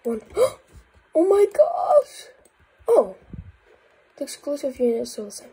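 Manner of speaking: usual speaking voice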